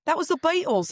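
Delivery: bending the vowels toward Liverpool; mock Liverpool accent. Teasing her cohost with a mock Liverpool accent. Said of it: "What accent was that?".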